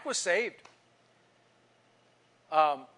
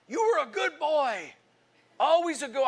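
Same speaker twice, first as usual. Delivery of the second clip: put-on old man's praise; 9 semitones higher, 3 dB louder than usual. Old neighbor to the grown boy, exclaiming.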